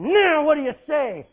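loud, high taunting challenge; challenging demand. Acted. Pharisees taunting Jesus with a loud challenge. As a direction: throw the question at him like a trap.

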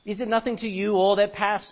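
loud, declaiming King James reading; scripture reading. Acted. Preacher reads Lamentations to congregation, declaiming. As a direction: proclaim the verse to the hall.

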